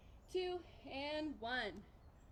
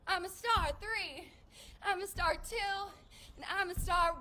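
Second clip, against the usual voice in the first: cheering while jumping; 6 semitones higher, 5 dB louder than usual. Shown as a demonstration. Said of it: "if you say".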